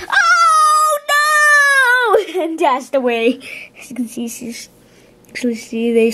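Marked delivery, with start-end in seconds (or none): 0.1-2.4 high, loud shrieked cry. Acted. Frightened beetles shrieking as they flee. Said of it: "they shrieked".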